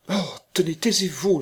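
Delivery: high-pitched, urgent cutting in; agitated interruption. A narrator's reading. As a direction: cut him off, voice jumping up. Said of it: "l'interrompit-elle". (interruption vive et affolée)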